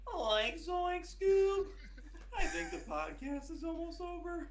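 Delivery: very high, loud, far-off Shaggy voice; Shaggy impression. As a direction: yelp it like a scared stoner cartoon. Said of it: "let me know if you guys can guess this one".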